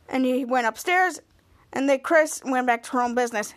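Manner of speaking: ordinary delivery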